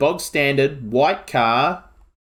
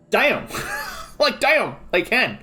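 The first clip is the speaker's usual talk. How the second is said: surprised; 9 semitones higher, slower than usual. Real, not acted. Surprised at car speed.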